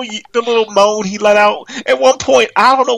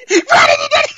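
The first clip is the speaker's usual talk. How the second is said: mock excited cry; slower than usual. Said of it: "Your boy was like".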